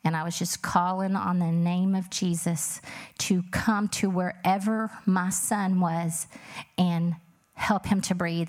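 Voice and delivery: ordinary delivery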